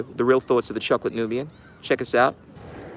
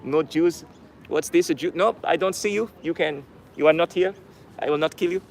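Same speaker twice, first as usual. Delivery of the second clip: put-on German accent, pitched a little up; attempted German accent; 4 semitones higher than usual. Soldier speaking to people hiding in the attic, in a put-on German accent. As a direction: play it in an amateur German accent. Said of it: "trying to do a German accent".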